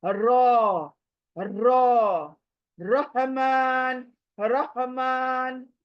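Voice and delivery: रॉ and रहमान slow with a thick rounded ra; heavy Arabic ra. Shown as a demonstration. Teacher drilling the heavy ra. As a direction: say रहमान very slowly, mouth full. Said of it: "फिर उसको मोटा पढ़ना है".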